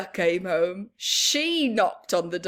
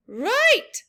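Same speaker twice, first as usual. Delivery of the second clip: put-on eager kid; 11 semitones higher, 7 dB louder than usual. Sheila's eager agreement.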